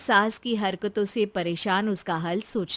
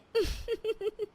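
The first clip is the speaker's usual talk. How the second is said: soft, high titter; quick, quiet high laugh; amused giggle; 12 semitones higher, 8 dB quieter than usual. Acted. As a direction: giggle lightly at your own joke. Wife giggles at her joke.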